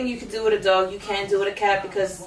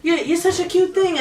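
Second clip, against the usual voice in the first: cooing lady; 9 semitones higher, slower than usual. Retold or imitated. Lady coos at dog.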